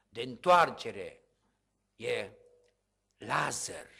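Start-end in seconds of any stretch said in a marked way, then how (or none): none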